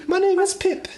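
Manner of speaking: put-on very high childlike British voice; little English boy. Joining the bit as a small boy. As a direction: chirp it like a tiny orphan. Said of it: "even younger".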